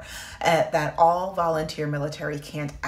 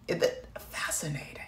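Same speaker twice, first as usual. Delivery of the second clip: whispered aside; 6 dB quieter than usual. Real, not acted. Aside reading the rules.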